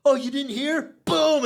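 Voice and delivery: pumped-up bro greeting. Retold or imitated. Mimicking a tipsy dad greeting him at parties, high hyped bro voice. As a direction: play the overeager buddy dad. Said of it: "He's like".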